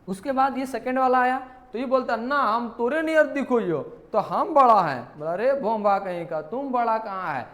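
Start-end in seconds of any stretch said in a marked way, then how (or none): 2.2-5.0 loud boastful rustic claim; bragging shout. Acted. Curly bracket brags to big bracket, loud and boastful. 5.3-7.5 mock scolding. Big bracket scolds the other.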